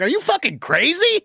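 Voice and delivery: high-pitched incredulous telling-off; scolding uncle. Retold or imitated. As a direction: scold like an exasperated relative, pitch way up. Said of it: "talking to her like I was her uncle".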